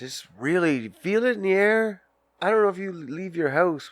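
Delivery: ordinary talk, nothing marked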